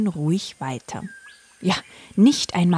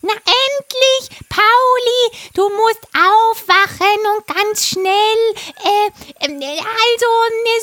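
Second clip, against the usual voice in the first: high, loud parrot voice; a frantic parrot urging; excited parrot; 6 dB louder than usual. Acted. Parrot voice held. (hohe, aufgeregte Papageienstimme)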